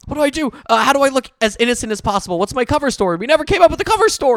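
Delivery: fast, high panic; panicked. Acted. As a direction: babble in rising panic. Panicking before the cop.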